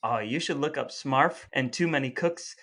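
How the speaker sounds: ordinary delivery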